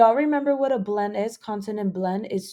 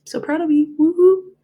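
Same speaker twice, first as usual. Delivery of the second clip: cheering; 7 semitones higher, 8 dB louder than usual. Real, not acted. Praising class.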